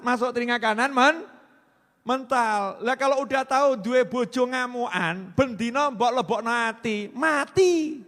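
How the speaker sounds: loud, high comic sermon; preacher joking loudly about marriage; fiery preaching. Acted. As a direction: hammer the joke home to the hall. Comic marriage advice at volume.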